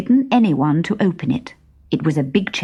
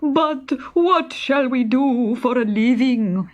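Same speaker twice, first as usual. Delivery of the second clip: slow, plaintive bandit voices; lightly voiced fretting bandits; worried bandits; 7 semitones higher, slower than usual. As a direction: ask it helplessly. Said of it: "all three said".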